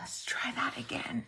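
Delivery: breathy whispered aside. Real, not acted. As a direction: whisper it under your breath. Unvoiced whisper.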